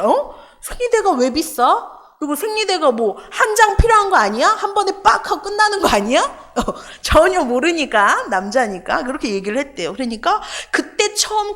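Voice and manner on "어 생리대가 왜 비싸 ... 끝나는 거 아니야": higher-pitched puzzled surprise, imitating a clueless man; surprised, retold. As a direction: act out his startled questions in a raised pitch. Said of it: "깜짝 놀랐대요". (깜짝 놀라 되묻는 남자 흉내)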